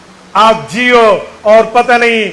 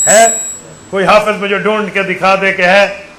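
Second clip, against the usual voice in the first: ordinary delivery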